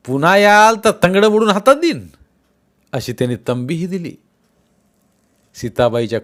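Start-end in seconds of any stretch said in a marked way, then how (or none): 0.1-2.1 loud, high threatening shout; angry threat. Acted. Villagers warning the shepherds off, shouted threat. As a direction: bark the threat at them.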